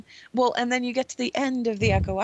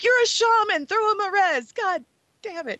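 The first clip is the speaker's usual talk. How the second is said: exasperated outburst; 12 semitones higher than usual. Retold or imitated. Player yelling at the shaman to resurrect him, exasperated. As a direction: scold her in disbelief. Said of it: "I'm like".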